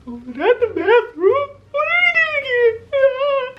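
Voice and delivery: very high, slow, squealing disbelief; drawn-out high-pitched shocked squeal. Retold or imitated. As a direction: shriek it out like you can't believe he's there. Shocked he's in store bathroom.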